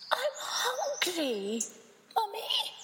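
high, slow, whimpering little voice; hungry baby bird. Acted. Baby bird to Mummy, high whimpering plea. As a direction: whine it like a tiny hungry chick. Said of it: "She went".

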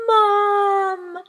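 high loud call for mum; crying out. A narrator's reading. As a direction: call out desperately. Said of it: "he cried".

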